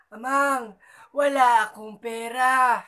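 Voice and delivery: high, slow pleading granny; old granny voice. Acted. Granny pleading with the robber, high and slow. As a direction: beg like a frail old lady. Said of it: "Sabi ni Lola".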